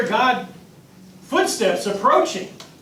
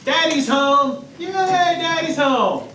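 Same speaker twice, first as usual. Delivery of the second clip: higher, slow, delighted sing-song; excited child; 9 semitones higher, slower than usual. Retold or imitated. Innocent child greeting father, excited and slow. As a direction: beam like a kid at the door. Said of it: "Just like the, the child".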